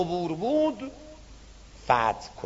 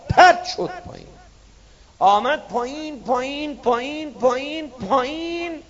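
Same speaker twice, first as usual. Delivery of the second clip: sudden cry of falling; 6 semitones higher than usual. Acted. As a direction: shout the fall out high. Climber falls off the cliff. (فریاد ناگهانی سقوط، بلند و زیر)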